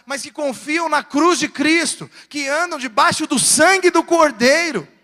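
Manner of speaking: rising, loud pulpit climax; emphatic preacher crescendo; forceful preaching. Acted. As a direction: swell to a proclamation for the congregation.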